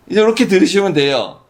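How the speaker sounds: usual speaking voice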